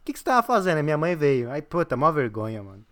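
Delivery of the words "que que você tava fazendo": high-pitched mock scolding, rushed in places; half-hearted imitation of a dad telling off; retold angry dad. Retold dad scolding about photos.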